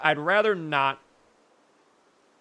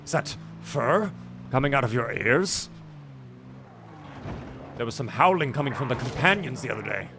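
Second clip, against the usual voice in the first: put-on Skyrim guard voice; quoting game guards. Player reciting Skyrim lines after hearing wolves howl, quoting game guards.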